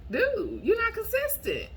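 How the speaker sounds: high, loud telling-off; exasperated. Retold or imitated. Scolding the guy who texts once a month, high and loud.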